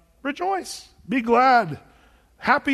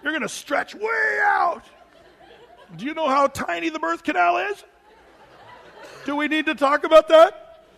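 much higher, loud, half-laughing fake panic; mock alarmed reaction; 8 semitones higher, 5 dB louder than usual. Mocking what nobody tells an expectant mom, in loud laughing alarm. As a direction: ham up a horrified friend hearing pregnancy news. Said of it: "nobody goes".

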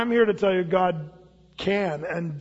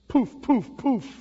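magic poof; 5 semitones higher, slower than usual. Pretend. Genie granting wishes, mock magic.

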